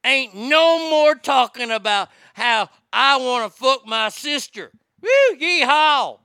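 hammed-up Southern accent with a yeehaw. Host mocking old Southern incest jokes, Southern accent. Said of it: "The Southern jokes end".

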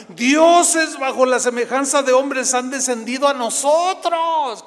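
loud, high, drawn-out acclamation; crowd raising voice. Acted. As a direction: cry it out like an awestruck crowd. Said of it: "alzó la voz. La gente empezó". (aclamación a gritos de la multitud)

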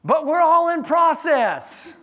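high, loud preaching build, nearly shouting; full-force preaching. Acted. Pastor preaching to the congregation, loud and high. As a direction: proclaim it over the congregation.

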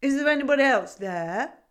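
loud, high goat question; half-deaf goat checking who's there; gruff goat query. A narrator's reading. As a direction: boom the question. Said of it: "queried the goat".

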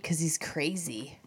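whisper. Real, not acted. Aside about Creed.

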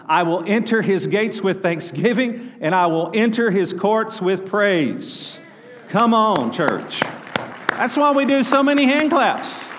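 loud, high-pitched preacher rallying the church; fired-up preaching. Real, not acted. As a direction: preach it out with rising force. Preaching at full force.

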